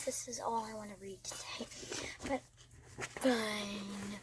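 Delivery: quiet unvoiced whisper; hushed morning whisper. Real, not acted. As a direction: whisper so no one wakes. Whispering in bed.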